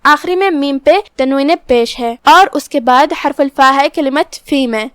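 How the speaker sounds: usual speaking voice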